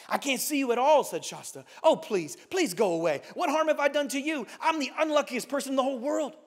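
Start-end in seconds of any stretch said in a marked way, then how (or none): 0.1-1.0 a touch of fearful urgency; lightly acted scared protest; frightened boy. A narrator's reading. Terrified boy answering the unseen thing, mildly frightened. 1.8-6.3 lightly acted begging boy; a touch of desperate complaint; frightened pleading. A narrator's reading. Terrified boy begging the unseen thing, pleading.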